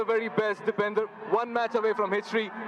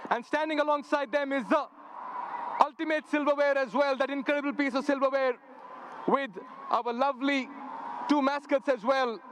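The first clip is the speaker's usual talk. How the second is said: big stadium MC hype; rally the crowd like an MC; announcer; 7 semitones higher than usual. Real, not acted. Hyping crowd at the toss.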